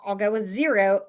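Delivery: usual speaking voice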